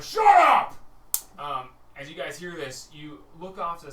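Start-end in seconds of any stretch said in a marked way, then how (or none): 0.0-0.7 explosive high scream; very loud acted orc yell; furious interruption. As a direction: scream over the one talking. Orcs silence the smaller one.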